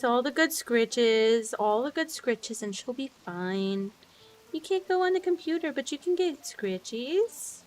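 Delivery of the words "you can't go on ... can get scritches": pet talk. Real, not acted. Cooing at pet.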